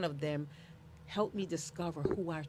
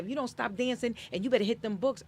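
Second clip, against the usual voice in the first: lightly voiced scolding mom, quick and higher; quick, higher-pitched parental warning; scolding mother; 5 semitones higher, 4 dB louder, faster than usual. Mother warning her child off dancing, mildly scolding. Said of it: "mommy said".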